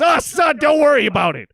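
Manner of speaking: high, loud dad voice; dad calling back up to his son; dad's reply. Retold or imitated. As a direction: brush the son off, loudly. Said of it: "He says".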